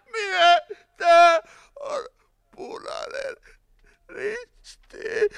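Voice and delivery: high, broken whine fading away; dying whine. A narrator's reading. Burning man's last plea drowned by flames, whining weakly. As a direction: let the plea fade out word by word. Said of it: "Sitten ääni hukkui liekkien rätinään".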